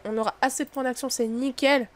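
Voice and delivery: ordinary talk, nothing marked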